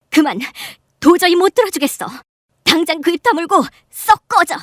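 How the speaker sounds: high, loud shout to shut her up; furious shout. Acted. Mother slamming the table at the aunt, yelling. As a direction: explode at her to get out.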